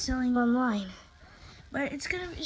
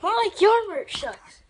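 loud, high taunt; loud comeback; 8 semitones higher, 8 dB louder than usual. Real, not acted. He throws his brother's insult back with a loud taunt. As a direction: fire the insult back at him.

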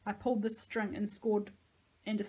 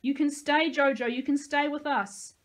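raised, higher voice, pleading; shouted plea; 6 semitones higher, 7 dB louder than usual. A narrator's reading. Nico begs Josie to stay, shouted plea. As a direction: call out an offer to stay.

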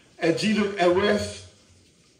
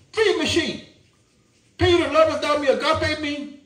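a higher, earnest Jesus asking Peter; Jesus' tender question; 7 semitones higher than usual. Acted. Jesus asks Peter a third time, earnest and higher. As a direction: voice Jesus pleading gently, lifted up.

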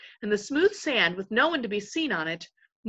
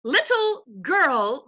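higher, louder, slowly drawn-out affront; indignant echo; 6 semitones higher, 6 dB louder, much slower than usual. A narrator's reading. Reepicheep defending the queen's dignity, indignantly. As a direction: repeat it as an outrage. Reepicheep offended on Lucy's behalf.

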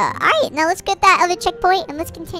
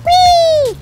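high, loud squeal of fun; playful whee; 12 semitones higher, 5 dB louder than usual. Pretend. Gamer sent flying as a fish, playful whee.

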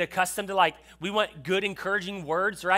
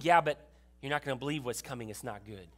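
much lower and softer, ominous; the Lord's grave reply; 5 semitones lower, 3 dB quieter than usual. Acted. The Lord telling Habakkuk worse is coming, low and ominous. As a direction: drop it low and quiet like a warning. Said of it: "the Lord ... says".

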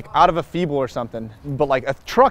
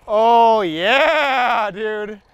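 high, loud, drawn-out cheer; elated celebration; 7 semitones higher, 4 dB louder, much slower than usual. Real, not acted. Rider cheering after landing it, elated drawn-out holler. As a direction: whoop it out, stretching the words.

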